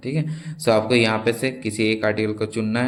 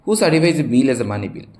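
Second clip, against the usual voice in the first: reading a question; 4 semitones higher, 5 dB louder, slower than usual. Shown as a demonstration. Teacher reads quiz question aloud.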